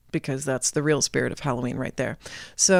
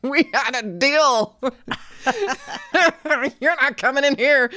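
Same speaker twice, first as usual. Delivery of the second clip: higher, louder, laughing through it; mocking devil; 9 semitones higher, 4 dB louder than usual. A narrator's reading. Devil refusing Jack entry to hell, laughing mockery. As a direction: gloat over the broken bargain.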